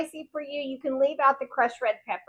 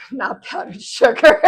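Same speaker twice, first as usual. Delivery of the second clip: giggling through the words; laughing; 4 semitones higher, 8 dB louder, slower than usual. Real, not acted. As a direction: laugh at your own mistake. Laughing at own slip.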